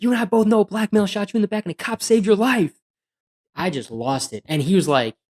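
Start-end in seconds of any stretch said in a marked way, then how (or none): 0.0-2.7 higher and louder, furious outburst; going off on him. Retold or imitated. Cop yelling at the man who lied about police, furious. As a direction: blow up in disbelief. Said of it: "I went off on the guy".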